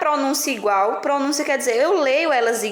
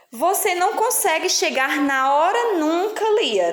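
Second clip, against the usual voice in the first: amused reading; 7 semitones higher than usual. Shown as a demonstration. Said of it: "Tá fazendo uma pergunta".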